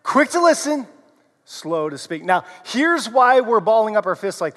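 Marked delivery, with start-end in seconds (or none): 0.0-0.9 higher, brisk; quick and bright. Shown as a demonstration. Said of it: "one more time". 1.4-2.2 softer and lower; slow and low. Shown as a demonstration. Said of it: "one more time".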